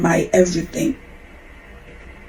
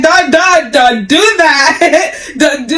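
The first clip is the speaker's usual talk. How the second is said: higher, half-laughing mock protest; laughing refusal; 6 semitones higher, slower than usual. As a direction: playfully wave off the request. Mother refusing son's sweet talk.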